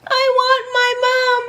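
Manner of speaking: high, loud frightened little bear; scared bear. Acted. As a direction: whine it like a scared cub. Frightened bear's line.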